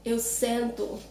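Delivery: sento with a nasal en vowel; contrast vowel. Shown as a demonstration. Teacher contrasts sit with feel, sento with nasal e. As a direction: say sento as SEN-too, not seen. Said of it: "when I say to sit down".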